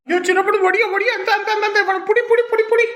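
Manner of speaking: high-pitched, rapid repeated plea; flustered urgency. Acted. Frazzled parent handing over the crying baby, flustered urgency. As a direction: call out urgently for help, voice climbing.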